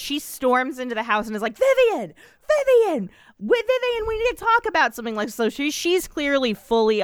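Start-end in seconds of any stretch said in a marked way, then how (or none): 1.6-5.1 high, then rushed at the end; imitating a frantic woman barging in; mock urgent demand. Retelling Hayden storming into the house, in a high mock-urgent voice.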